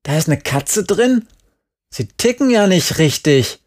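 high, incredulous outburst; outraged cry. A narrator's reading. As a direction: question in disbelief, then scold. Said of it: "rief Jerry".

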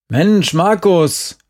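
exasperated call from outside. A narrator's reading. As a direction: call slowly to someone behind a door. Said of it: "rief Uwe jetzt von außen".

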